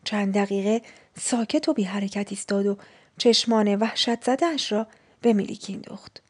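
ordinary delivery

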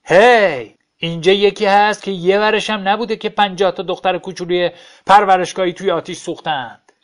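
shouting to the whole bar to shame him. Acted. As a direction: yell it out to the whole room. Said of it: "فریاد زد".

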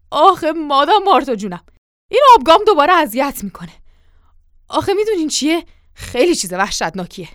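loud, whiny protest; cornered, protesting excuses; pleading excuse. Acted. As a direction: plead your way out of it. Servant pleads with angry mistress.

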